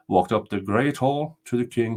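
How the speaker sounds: ordinary delivery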